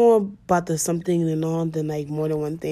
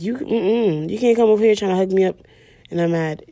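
louder, fast, sassy telling-off; mock angry scolding; 2 semitones higher, 7 dB louder than usual. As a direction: scold him for trying to hug her. Acting out her mad reply.

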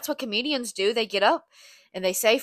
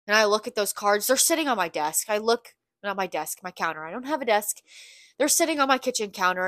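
usual speaking voice

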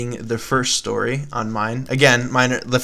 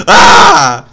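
startled yell; 8 semitones higher, 13 dB louder than usual. Acted. Startled by Eli with knife.

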